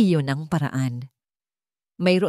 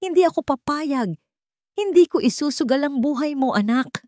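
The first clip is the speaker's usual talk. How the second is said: much higher, urgent frightened refusal; a mother terrified for her child; fearful pleading; 10 semitones higher than usual. Acted. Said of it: "Ang kanyang boses ay puno ng takot". (nanginginig sa takot na pagtutol)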